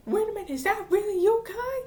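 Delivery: high, loud, rapid disbelief; mock freak-out. Mother and brother see the changed girl, mock freak-out. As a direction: act out a stunned family, pitch shooting up. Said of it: "her mother and her brother freak out".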